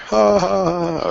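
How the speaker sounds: high, loud "ha ha ha"; hammed-up high laugh; mock laugh. Real, not acted. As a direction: laugh at your own pun. Laughs at own joke.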